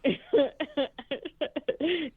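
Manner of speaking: long, high, soft laugh; amused laughter. Real, not acted. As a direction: crack up lightly at a joke. Amused by co-host's theory.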